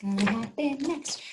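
ordinary delivery, nothing marked

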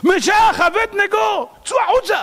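high, drawn-out yell of names; calling out shout. Acted. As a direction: holler to people inside to come out. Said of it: "הוא התחיל לצעוק להם".

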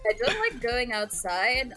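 ordinary talk

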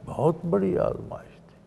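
quiet, lifted aside after a pause; hushed emphasis. Real, not acted. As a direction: say it softly, letting the weight sink in. First take, softer, before emphatic repeat.

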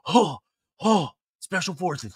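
mock heavy huffing; imitating a man panting through cold shock; deep exhaling. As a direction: puff out short breaths between words. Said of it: "He's exhaling deeply".

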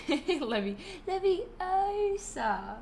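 high, drawn-out British schoolgirl correction; Hermione. Acted. Streamer quoting the only film line she remembers, high British impression. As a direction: stretch Leviosa high on the O. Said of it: "that's the only line I remember".

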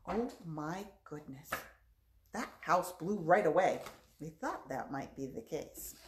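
ordinary talk

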